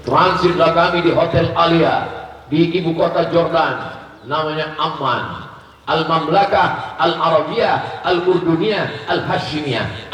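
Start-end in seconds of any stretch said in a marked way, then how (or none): 5.9-10.1 Al-Mamlakah Al-Arabiyah Al-Urduniyah Al-Hashimiyah slowly in full Arabic sounds; Arabic pronunciation. Shown as a demonstration. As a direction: spell out the Arabic name slowly, syllable by syllable. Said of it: "Al-Mamlakah karena dia sistem kerajaan".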